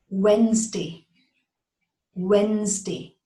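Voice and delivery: Wednesday said as two syllables, WENZ-day, no D; Wednesday with a silent D, WENZ-day; correct Wednesday. Shown as a demonstration. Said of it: "The D is silent ... three syllables".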